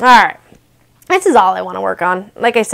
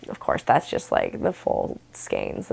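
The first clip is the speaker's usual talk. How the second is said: whispered aside; 10 dB quieter, slower than usual. Real, not acted. Aside off camera.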